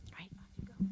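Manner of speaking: soft whispered send-off. Real, not acted. Sending the children off after prayer, whispered. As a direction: whisper them off kindly.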